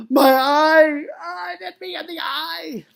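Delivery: high, loud hammed-up cry of pain; overdramatic wail. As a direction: shriek as if hit, wildly overacting. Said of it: "I overact a little bit".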